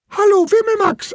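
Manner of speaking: very high, tiny squeaky voice; little potato. A narrator's reading. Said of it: "antwortet die kleine Kartoffel".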